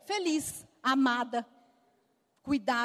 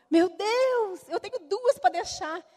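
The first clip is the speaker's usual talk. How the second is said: high, louder, flustered worry; anxious outburst; 7 semitones higher, 5 dB louder, slower than usual. Retold or imitated. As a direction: panic about who will watch the girls. Worried about leaving her daughters. (aflita e nervosa, mais aguda e alta)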